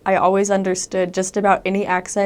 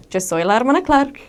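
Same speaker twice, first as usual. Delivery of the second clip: "Yo soy la hermana Clark" lilting up high and louder; sing-song; 5 semitones higher, 5 dB louder than usual. Retold or imitated. Missionary introducing herself, "Yo soy la hermana Clark" in sing-song tones. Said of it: "like they were singing when they'd introduce themselves".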